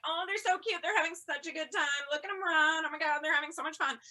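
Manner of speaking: high, delighted cooing over the dogs; gushing cheering. Retold or imitated. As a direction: gush like a proud crowd of dog owners. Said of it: "we were all, like, cheering them on".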